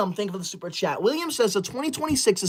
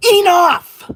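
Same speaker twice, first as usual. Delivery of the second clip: loud, high explosive yell; fed-up shout; 6 semitones higher, 7 dB louder than usual. Real, not acted. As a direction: burst out at the end of patience. Fed up with cat ears.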